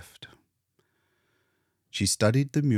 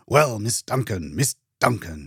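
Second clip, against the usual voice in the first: louder, hearty doctor greeting; booming; 3 semitones higher, 5 dB louder than usual. Acted. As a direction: boom it out with self-importance. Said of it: "he boomed with importance".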